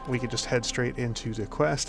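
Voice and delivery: ordinary talk, nothing marked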